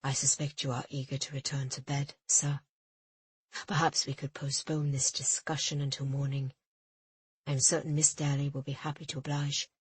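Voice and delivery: smooth things over gently; calm mate placating the official; smooth Jin. A narrator's reading. Jin's voice held.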